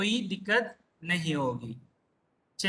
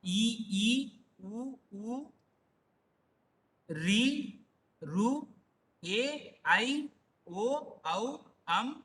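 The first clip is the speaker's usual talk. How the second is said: vowel list recitation; much slower than usual. Shown as a demonstration. Teacher pointing out all the matras, measured recitation. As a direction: list the vowels slowly, one by one. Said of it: "सभी के मात्राएं हैं".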